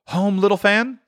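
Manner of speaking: put-on high, questioning boy's voice; young boy. A narrator's reading. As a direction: ask it as a hopeful schoolboy. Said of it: "returned the boy".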